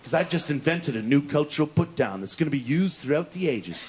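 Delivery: low, drawn-in, self-important gloating; low, portentous self-congratulation; smug inner boast. Acted. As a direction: savour the triumph in a deep voice.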